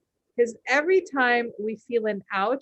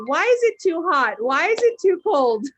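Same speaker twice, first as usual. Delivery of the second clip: much higher and louder mock whine; whiny complaining; 7 semitones higher, 8 dB louder than usual. Mocking people who moan about heat and cold. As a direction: ham up a petty weather complaint. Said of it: "most people will be like".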